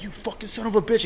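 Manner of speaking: quick, high-pitched mock cursing; mock angry dad. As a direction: imitate a dad cursing at another driver, fast. Retelling dad cursing monster truck.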